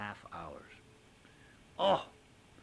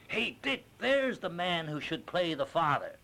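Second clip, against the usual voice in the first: calling out high and bright to her husband; mock-excited wife spotting him on TV; 8 semitones higher, 7 dB louder than usual. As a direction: point him out eagerly from the couch. Wife's excited call, retold.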